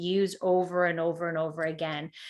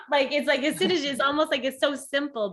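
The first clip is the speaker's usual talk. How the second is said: laughing while talking, higher; words bubbling through a laugh; 6 semitones higher than usual. Real, not acted. Laughing at friend's simple advice.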